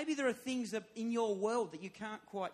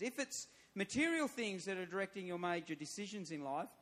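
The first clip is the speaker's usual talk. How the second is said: ordinary delivery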